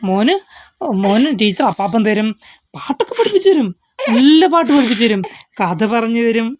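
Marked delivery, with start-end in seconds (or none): 2.7-5.2 high coaxing. Real, not acted. Coaxing crying child with promises.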